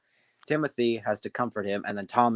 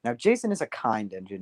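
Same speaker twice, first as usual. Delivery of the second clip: usual speaking voice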